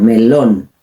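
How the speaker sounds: "melón" low, as me-LON; "melón" with firm weight on -lón; strong final stress. Shown as a demonstration. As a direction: punch the last syllable of "melón". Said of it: "Es strong".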